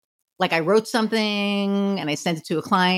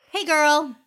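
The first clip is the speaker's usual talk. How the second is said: put-on bright, higher friendly hey girl; chirpy greeting; 7 semitones higher than usual. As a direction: sing out a breezy hello. Cheerful greeting in retold message.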